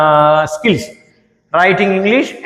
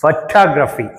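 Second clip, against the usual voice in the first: Photography with a weak opening and strong second syllable TOG; stress on second syllable; 3 semitones lower, much slower than usual. Shown as a demonstration. Teacher modelling for students, second syllable of photography stressed. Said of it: "talk, దీనికి stress ఉంది".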